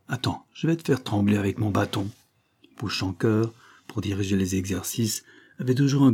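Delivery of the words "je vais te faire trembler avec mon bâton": usual speaking voice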